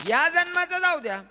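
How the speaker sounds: high, ringing repeat; preached repeat. Acted. Sage repeating his verdict, high and ringing. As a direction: lift it to the crowd.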